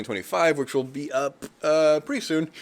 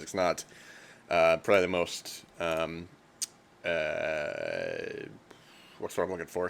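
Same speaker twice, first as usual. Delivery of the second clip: usual speaking voice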